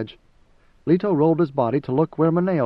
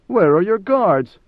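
a loud, sharp, slow demand, higher; sudden demand; 5 semitones higher, 6 dB louder, slower than usual. Acted. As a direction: demand it loudly, each word weighed. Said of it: "Mineo suddenly demanded".

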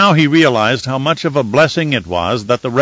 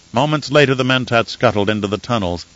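ordinary delivery

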